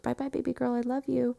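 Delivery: high, soft sweet farewell; tender goodbye. Retold or imitated. Mother parting from her newborn baby, tender.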